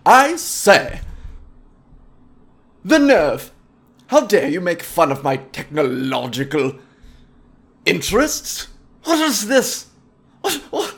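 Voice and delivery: sputtering "I say, the nerve"; offended snob. Pretend. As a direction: play an outraged upper-crust nerd. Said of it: "were like".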